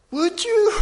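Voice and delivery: high falsetto, loud amazed asking; put-on very high voice, pleading wonder; imagined divine question. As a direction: squeak it out in hopeful disbelief. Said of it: "I can hear him now".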